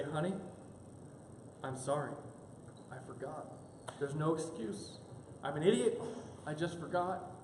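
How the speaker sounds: low, soft, halting mock apology; apologetic, penitential. Husband facing angry wife, acting utterly penitent. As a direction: plead meekly, pausing after each admission. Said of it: "you muster the most apologetic, penitential tone".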